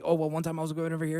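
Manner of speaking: gabbled story, very fast and loud; mock fast talker. Mocking a nervous rambler to the audience, breathless rush. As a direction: rush it like you can't stop talking. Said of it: "they're talking really fast".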